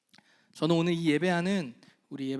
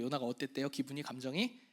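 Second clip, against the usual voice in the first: normal talking voice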